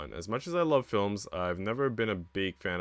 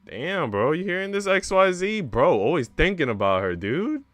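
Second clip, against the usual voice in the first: much higher, louder hype; excited reaction; 9 semitones higher, 7 dB louder than usual. Real, not acted. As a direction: squeal with delight at the chat. Excited reaction to romance scene.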